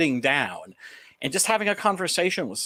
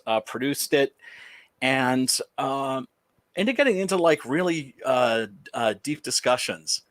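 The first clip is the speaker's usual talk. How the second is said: usual speaking voice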